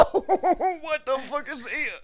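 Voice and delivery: loud, drawn-out shocked laugh; laughing disbelief. Real, not acted. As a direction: blurt it out through shocked laughter. Shocked at friend's candle.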